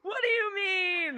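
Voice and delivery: high, slow, disbelieving squeal; excited shriek. Real, not acted. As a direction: cry it out in shock. Shocked at shared school history.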